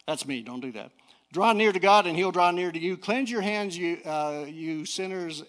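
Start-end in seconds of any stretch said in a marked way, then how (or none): 1.3-5.4 loud, higher preacher's reading; declaimed scripture. Real, not acted. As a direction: proclaim the verse to the congregation.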